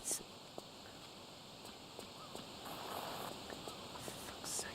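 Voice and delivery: exasperated whispered swear; whispering. Real, not acted. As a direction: mouth it under your breath. Muttered curse at game.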